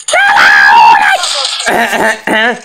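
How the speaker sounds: loud laughing shout. Real, not acted. Laughing shout after fight.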